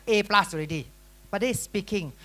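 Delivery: ordinary talk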